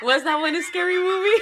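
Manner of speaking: laughing. Real, not acted. Asks while still laughing.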